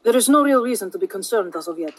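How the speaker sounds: put-on measured delivery with a light Indian accent; slightly Indian accent. A narrator's reading. Holson says there's no cause for concern, slightly Indian accent. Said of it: "and slightly Indian".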